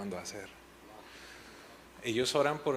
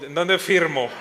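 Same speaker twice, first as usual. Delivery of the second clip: louder and higher, laughing through it; eager joking shout; 6 semitones higher, 11 dB louder, slower than usual. Retold or imitated. Father reacting to his daughter's prophecy, eager laughing shout. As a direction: burst out as if signing up at once.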